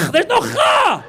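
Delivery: very high comic shriek of protest; exasperated shout. Acted. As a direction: yell it in mock outrage, voice shooting up. Protesting the wrong sound again.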